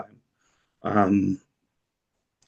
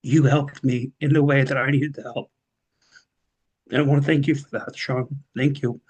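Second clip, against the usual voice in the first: a little higher, thanks held back by real emotion; emotional; 5 semitones higher than usual. Real, not acted. Former client thanking his old counselor Sean, emotional and moved. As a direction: thank your mentor while holding back tears. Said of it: "sorry, I'm getting emotional".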